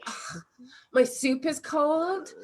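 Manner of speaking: mock outraged diner; hammed-up fussy customer; disgusted complaint. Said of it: "you're like".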